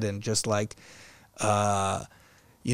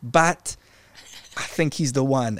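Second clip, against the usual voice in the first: put-on high, slow South African accent; 5 semitones higher, 4 dB louder, slower than usual. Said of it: "I'm gonna try to do, like, the South African accent".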